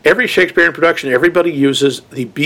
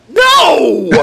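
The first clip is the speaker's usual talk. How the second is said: loud, high, drawn-out no; exasperated shout; 12 semitones higher, 4 dB louder than usual. Acted. Host rejecting movie booby traps, exasperated shout. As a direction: shout it out in comic outrage. Mock outrage at working traps.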